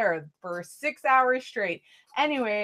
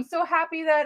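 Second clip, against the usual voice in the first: ordinary talk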